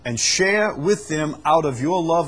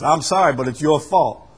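louder sorry that turns to blame, "I'm sorry" then snapping "your fault"; left-handed apology; 3 semitones higher, 7 dB louder than usual. Acted. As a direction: apologize while slapping them with blame. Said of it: "the kind of apology that is".

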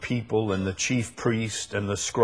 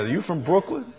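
high, rising imitated question; curious questioning; 7 semitones higher, faster than usual. People ask the preacher about his origins, curious questioning. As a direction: ask it high, lifting at the end.

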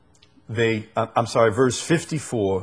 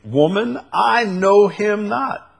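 higher, drawn-out protest; emphatic denial; 9 semitones higher, 5 dB louder than usual. Acted. Peter denies Jesus to the maid, emphatic denial. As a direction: deny it loudly, stretching the words. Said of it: "And he denied him".

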